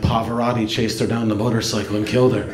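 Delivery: low, quick, dim big-brother impression; dopey brother voice. Little brother telling his sibling the news, low and dopey. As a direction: play him low and earnest, a bit dense. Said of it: "He's like".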